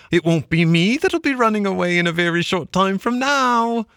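high-pitched retelling voice; Mole's excited tale. Acted. Mole recounting the stoat sergeant, pitched high. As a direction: relish every word of the trick.

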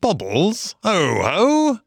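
high, swooping cheerful call; cheery, inviting chirp. A narrator's reading. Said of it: "chirped cheerily in an inviting sort of way".

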